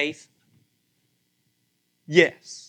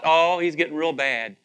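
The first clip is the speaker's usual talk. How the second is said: normal talking voice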